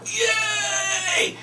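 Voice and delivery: high, hammed-up squeal of glee; squeaky fake triumph; mock victory cry. Shown as a demonstration. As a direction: crow like you've fooled them. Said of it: "And so you go".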